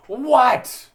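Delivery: loud, high mock-angry phone bark; mean. Answering a scam call, real mean. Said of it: "I would be real mean".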